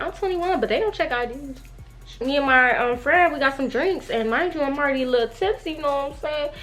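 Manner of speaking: normal talking voice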